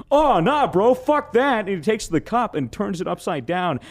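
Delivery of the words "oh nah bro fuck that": imitating Dylan. Storyteller imitating friend Dylan at poker, high brash dismissal. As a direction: toss it off like a reckless stoned friend. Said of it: "my friend Dylan goes".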